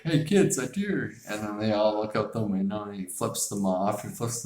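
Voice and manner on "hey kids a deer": a hint of a dad's false cheer; mock excited dad. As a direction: sell the fake deer sighting to the kids. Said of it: "and he's like".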